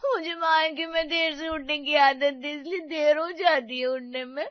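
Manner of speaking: tearful, whining self-defence; bursting into tears. Acted. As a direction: sob and complain, hammed-up. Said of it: "वो अचानक से रोने लगी".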